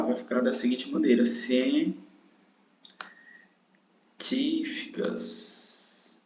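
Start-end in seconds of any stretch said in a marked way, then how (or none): 1.2-6.2 científicas very slowly, long gaps between syllables; split into syllables. Shown as a demonstration. Teacher separating the word's syllables, slow and soft científicas. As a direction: draw out científicas softly, syllable by syllable. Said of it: "se você for separar".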